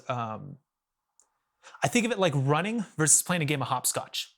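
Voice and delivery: usual speaking voice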